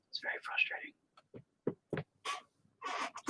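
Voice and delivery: breathy mock-confiding whisper; whispered aside. Real, not acted. Artist whispering a joke aside to viewers. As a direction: drop to a whisper. Aside after complaining about film.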